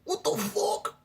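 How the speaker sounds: very high, bewildered squeak; a startled, clueless what; confused. Retold or imitated. Stoned guy learning his nose bleeds, high bewildered squeak.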